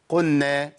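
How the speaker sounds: "قلنا" as "qunna"; "قلنا" with lam melted into nun; merged lam. Shown as a demonstration. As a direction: double the n in "قلنا", no l. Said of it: "من إدغامها".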